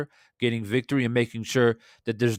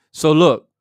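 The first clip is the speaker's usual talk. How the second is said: loud, higher "So look" to reset; emphatic call; 5 semitones higher, 10 dB louder than usual. Real, not acted. Streamer moving the chat on to the fight topic, loud. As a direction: snap the room to attention.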